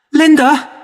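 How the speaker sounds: put-on high, loud cry of a scared child; frightened cry. A narrator's reading. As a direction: call out in fear. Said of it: "he cried out".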